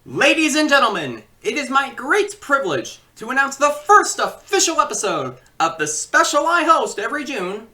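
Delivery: loud, high, grand announcer opening; showman announcer. Acted. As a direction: announce it like a ringmaster. Host announces the special.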